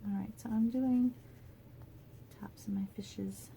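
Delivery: soft voiceless murmur while working; whispered self-talk. Real, not acted. Crafter colouring the fish, whispering to herself. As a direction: breathe the words while concentrating.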